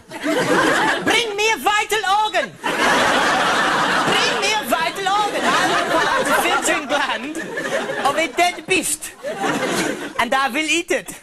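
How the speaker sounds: exaggerated German voice ordering liver; mock German accent. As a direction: bark it like a pompous German. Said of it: "what the Germans have for breakfast".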